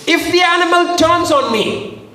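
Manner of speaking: very high, suspenseful declaration; bold boast. Acted. David testifying to Saul about wild animals, boldly. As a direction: pitch it right up to build the threat.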